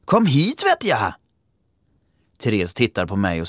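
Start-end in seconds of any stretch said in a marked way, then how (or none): 0.1-1.1 man calling. Acted. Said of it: "ropar en mansröst".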